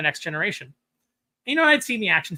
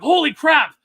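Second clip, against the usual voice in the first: amazed exclamation; 10 semitones higher, 10 dB louder, slower than usual. Retold or imitated. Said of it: "I was like".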